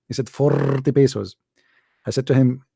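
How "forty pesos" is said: put-on slow, lightly Filipino-accented forty pesos. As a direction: imitate the Filipino bus conductor's accent. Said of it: "they have an accent there".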